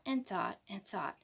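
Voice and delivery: whispered narration; secretive whisper. A narrator's reading. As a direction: hush it, as if thinking. Narrating Freddie thinking hard.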